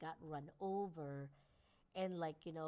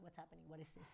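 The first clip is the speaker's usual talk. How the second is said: a quiet, puzzled dog voice; confused dog; 3 semitones lower, 14 dB quieter, faster than usual. Retold or imitated. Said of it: "he got all confused".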